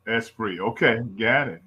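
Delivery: ordinary talk, nothing marked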